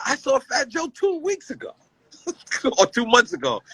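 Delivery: high, laughing put-on voice for the friend; a retold buddy, voiced high and amused; imitating Bleak. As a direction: mimic Bleak, giggling through it. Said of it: "he said".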